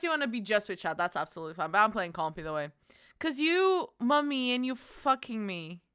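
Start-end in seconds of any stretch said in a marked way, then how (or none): none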